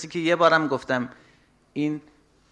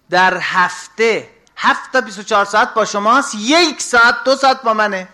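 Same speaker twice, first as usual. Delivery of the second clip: louder, higher reproachful sermon; preaching at full force; 8 semitones higher, 8 dB louder than usual. Acted. Preacher shaming listeners who skip dawn prayer, loud and reproachful. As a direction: scold the congregation from the pulpit.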